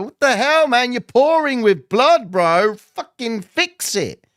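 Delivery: hammed-up yell of disbelief; mock outburst. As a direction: bark it at the bleeding guy like he's mad. Acted outburst at bleeding trader.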